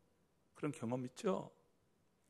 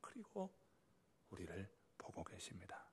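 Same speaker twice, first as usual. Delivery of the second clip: whisper; 4 semitones lower, 16 dB quieter than usual. Real, not acted. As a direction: drop to a breathy hush. Preacher drops to a whisper. (속삭이듯)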